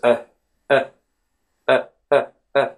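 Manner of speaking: short, loose "uh" sounds; just exhale a brief "uh", mouth slightly open; relaxed schwa. Shown as a demonstration. Teacher showing learners the schwa, short relaxed uh. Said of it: "open your mouth a little bit and just exhale".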